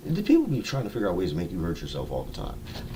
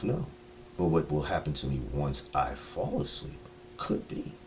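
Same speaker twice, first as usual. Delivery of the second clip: usual speaking voice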